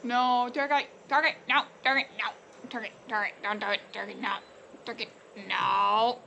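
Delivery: loud urgent pleading at the turkey; frantic shouting. Real, not acted. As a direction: yell no over and over, fast. Turkey about to jump off.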